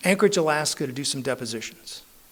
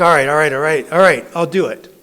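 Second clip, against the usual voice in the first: loud, exasperated surrender; fed-up yes, said loudly; loud giving in; 9 dB louder than usual. Retold or imitated. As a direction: throw your hands up, loudly. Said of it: "I'm like".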